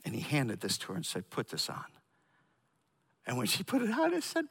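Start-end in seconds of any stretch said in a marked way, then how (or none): 1.3-1.9 quiet. Retold or imitated. Quiet intimate moment in story.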